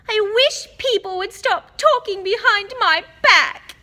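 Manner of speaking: high, loud, tearful ghost wail; choked with tears. A narrator's reading. Ghost girl complains of gossip, choked with tears. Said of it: "in a voice choked with tears".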